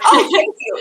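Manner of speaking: high, loud laughing thank-you. Retold or imitated. Thanking her own thought about Jacob, high laughing voice. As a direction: gush a giggly thanks at the reminder.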